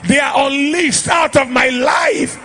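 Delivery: loud, high-pitched prophetic declaration; full-force preaching. Real, not acted. Preacher prophesying to the congregation, full-force declaration. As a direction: proclaim it over a crowd, ringing and emphatic.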